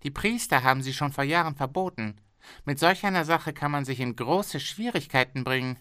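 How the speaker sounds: a pushy boss bargaining hard; haggling factory owner. Acted.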